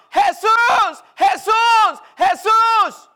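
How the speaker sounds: a blind man's rising, desperate calls; crying out. Acted. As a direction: cry out the name over and over as a blind beggar. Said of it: "comenzó a dar voces".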